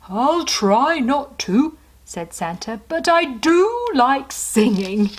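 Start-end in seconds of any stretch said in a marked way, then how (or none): 0.1-1.8 slow, higher, jolly Santa. Acted. Santa's voice in story. 2.9-5.1 slow, higher, jolly Santa. Acted. Santa's voice in story.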